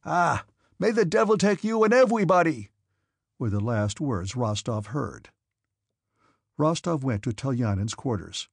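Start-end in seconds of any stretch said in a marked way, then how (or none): 0.0-2.7 high, loud exasperated shout; furious curse. A narrator's reading. Officer curses the departing cadet and everybody, furious shout. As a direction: hurl the curse after him. Denisov curses as Rostov runs out.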